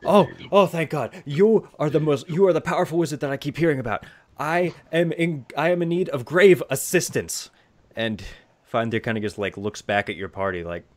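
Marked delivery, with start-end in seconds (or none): 0.1-7.5 high, raised, urgent pleading; shaken diplomat. Acted. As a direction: beg a powerful wizard for help. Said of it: "diplomat says".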